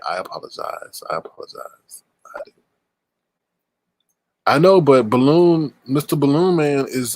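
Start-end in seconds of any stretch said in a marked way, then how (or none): 0.1-1.0 whispered apology. Pretend. Mock apology. 1.1-2.5 whispered apology. Pretend. Mock apology.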